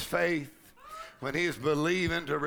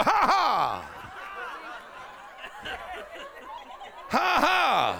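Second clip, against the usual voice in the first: punchy deliberate laugh, falling at the end; laugh of faith; 11 semitones higher, much slower than usual. Shown as a demonstration. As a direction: laugh without feeling it. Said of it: "You don't even have to feel nothing".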